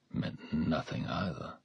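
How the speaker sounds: ordinary delivery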